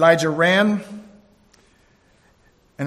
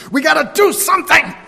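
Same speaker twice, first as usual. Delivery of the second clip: higher and louder, in a frantic mock rush; mock panic; 11 semitones higher, 8 dB louder than usual. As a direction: act out the anxious believer who must act now. Imitating anxious people.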